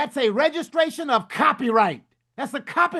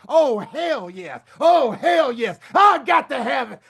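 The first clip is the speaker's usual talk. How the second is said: drawn-out diva exclamation, building in force; slow, swelling diva holler; slower than usual. Acted. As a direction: stretch each word like a soul diva, rising to a shout. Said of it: "in the [name] voice".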